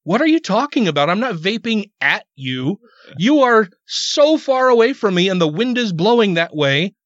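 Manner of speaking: retold irritation, punching the key words; a little mad. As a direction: argue back in annoyed disbelief. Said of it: "I got a little mad".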